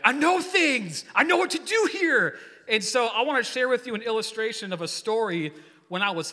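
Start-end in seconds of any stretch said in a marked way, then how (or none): 0.0-2.4 high, hammed-up boast; mock know-it-all cry, pitched way up; cocky proclamation. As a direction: crow it with overblown confidence. Acting out overconfident moment.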